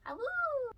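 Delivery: high playful werewolf howl; wolf howl. Pretend. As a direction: howl like a silly little wolf. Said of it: "I'm a werewolf".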